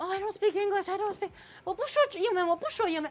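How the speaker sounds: high-pitched imitation of a protesting mother; hammed-up, high maternal protest. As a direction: feign helplessness in a high mom voice.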